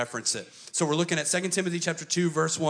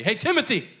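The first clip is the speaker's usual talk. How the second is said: higher, louder hyped coach; coach pep talk; 9 semitones higher, 6 dB louder than usual. Retold or imitated. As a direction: fire him up like a coach. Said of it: "Paul is a coach".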